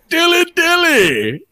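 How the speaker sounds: high, slow, drawn-out cheer of Dilly Dilly; hammed-up medieval toast; ad guy's call. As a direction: stretch Dilly Dilly up high and long like the ad guy. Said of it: "and then there was the guy".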